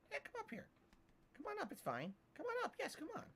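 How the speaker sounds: high, soft coaxing for the cat; coaxing pet voice. Real, not acted. Streamer coaxing his cat to come up, high and soft. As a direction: sweet-talk the cat up onto the desk.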